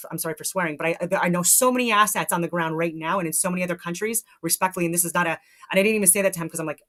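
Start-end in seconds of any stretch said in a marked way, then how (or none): none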